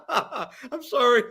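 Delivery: slow, laughing mock apology. Real, not acted. Friend laughing while mocking the sorry tackler.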